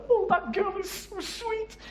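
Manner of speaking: high, slow Scottish imitation; imitating a Scottish colleague; Scottish accent. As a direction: mimic a colleague's Scottish lilt. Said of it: "in a Scottish accent".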